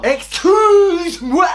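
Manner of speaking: drawn-out high indignant cry; slow mock-offended shriek; outraged excuse me. Outraged at absurd packing.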